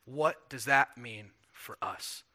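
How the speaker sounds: slowed question. Shown as a demonstration. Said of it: "slow, pointed question".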